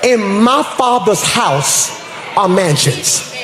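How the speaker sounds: slow, drawn-out preacher phrasing; slow for emphasis. Shown as a demonstration. Preacher spelling out a verse so the crowd catches it, slow. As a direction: stretch each word so they catch it. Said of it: "I'm gonna say this slow".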